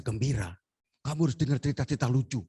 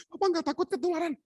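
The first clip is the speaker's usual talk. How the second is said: high, quick worried question; mock high-pitched wifely concern; imitated wife; faster than usual. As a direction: ask fast and high, playing the wife. Husband voices worried wife.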